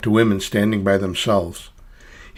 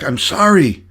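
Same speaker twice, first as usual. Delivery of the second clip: loud, high shouted apology; yelling back; 8 semitones higher, 7 dB louder, slower than usual. A narrator's reading. Clown calls back to tied-up Annie over the din, yelling. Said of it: "I tried to yell back".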